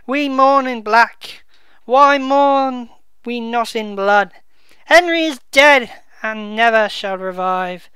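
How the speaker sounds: slow, loud, declaiming verse; dramatic Shakespeare reading. Acted. Bedford mourning the dead king, slow loud declamation. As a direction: proclaim it like a grieving duke on stage. Reads Exeter's lament aloud.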